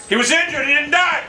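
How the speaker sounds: high-pitched, indignant outcry; indignant protest. Real, not acted. Gamer whose injured target didn't die, indignant protest.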